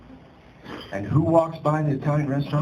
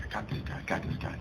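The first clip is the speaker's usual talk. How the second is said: hushed inner voice, unvoiced; a private whisper of triumph; whispered thought; 5 semitones lower, 12 dB quieter, faster than usual. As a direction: whisper it to yourself, sure of the win.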